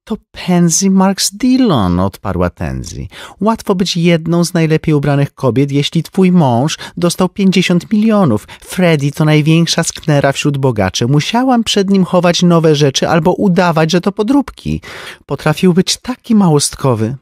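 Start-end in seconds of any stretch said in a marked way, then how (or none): 0.0-2.0 high, slow, drawn-out lady's voice; name-drop it slowly, high; Tenzi's voice. A narrator's reading. 3.4-17.2 high, lively society lady; a chatty rich woman gossiping; Tenzi's voice. A narrator's reading.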